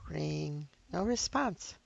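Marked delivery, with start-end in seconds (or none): none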